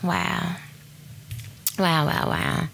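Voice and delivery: soft, low, overwhelmed wows; hushed awe. Real, not acted. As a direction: let the wonder hush you. Overcome, hushed.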